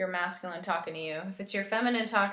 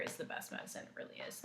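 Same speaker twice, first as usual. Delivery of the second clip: whispered aside; 17 dB quieter, faster than usual. Real, not acted. Aside about laughter as medicine.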